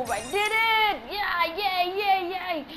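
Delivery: high, bright yay yay of victory; excited cheering. Real, not acted. Cheering after beating level.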